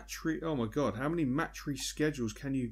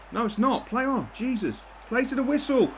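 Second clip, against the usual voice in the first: much higher, louder protest at the game; frustrated shout; 10 semitones higher, 4 dB louder than usual. Real, not acted. Gamer yelling at a missed offside call, angry. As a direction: yell at the screen in protest.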